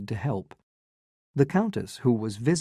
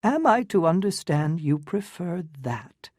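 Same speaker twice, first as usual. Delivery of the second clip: high, affected and unruffled; the aunt calmly needles him; calm arch aunt; 6 semitones higher than usual. Acted. Medora calmly needles Newland, high and affected. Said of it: "said Missus Manson calmly".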